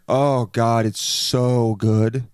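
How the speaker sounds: long, drawn-out "so good"; hammed-up foodie ecstasy; mock raving. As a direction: gush with stretched vowels. Diner raving to someone else.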